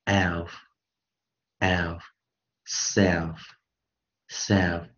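"Elf, self" with a short E, spaced slowly; short vowel. Shown as a demonstration. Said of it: "念短圆".

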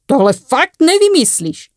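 high, drawn-out amused exclamation; stretched, high-pitched amusement; amused disbelief. A narrator's reading. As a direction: say it as if still tickled by your own story. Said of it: "Nemohl se přestat i po skončení svého vyprávění smát".

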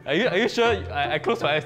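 usual speaking voice

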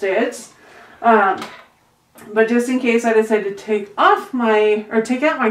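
muffled behind face covering; speaking with mouth covered; through a cloth mask. Real, not acted.

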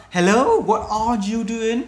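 loud, high, slow model greeting; upbeat greeting demo. Shown as a demonstration. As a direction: greet warmly and stretch it out. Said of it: "Đó". (chào hỏi mẫu to, chậm, giọng cao)

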